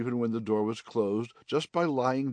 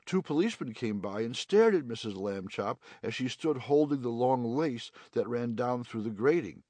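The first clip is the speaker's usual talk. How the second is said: plain reading voice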